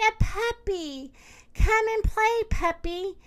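put-on high, sing-out little girl's call; excited cry. A narrator's reading. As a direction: coax a puppy over in a high voice. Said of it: "she cried".

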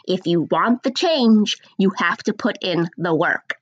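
slow, deliberate repeat; nice and slow. Shown as a demonstration. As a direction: spell it out slowly for them. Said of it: "I'm gonna say it nice and slow".

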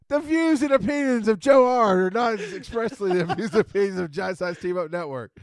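loud, high-pitched protest into disclaimer; mock alarmed shout. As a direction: shout over the joke to disown it. Cutting off singing with disclaimer.

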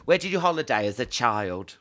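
ordinary delivery

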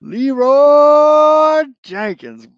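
long, drawn-out, high war cry; Leroy Jenkins battle cry. Pretend. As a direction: bellow the name like charging in. Shouting the famous battle cry.

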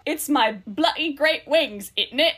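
slow, hammed-up Cockney bird; Cockney accent. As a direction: do a bad Cockney, drawing the words out. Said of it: "the Cockney accent comes out".

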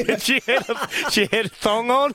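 cracking up mid-sentence; laughing while telling. Real, not acted. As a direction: describe it through laughter. Laughing at remembered funny video.

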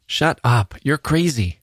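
acted, understated panic; horrified protest. Girl backs away, horrified by stranger.

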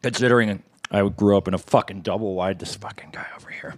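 unvoiced whispered aside; whispering. Real, not acted. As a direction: whisper it behind your hand. Whispered aside about himself.

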